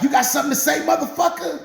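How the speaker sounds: high, loud angry challenge; imitating an irate wife on the attack; confrontational wife. Wife repeats challenge while chasing.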